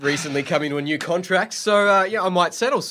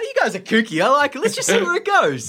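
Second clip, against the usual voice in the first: amused character aside; 10 semitones higher than usual. Acted. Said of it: "be like".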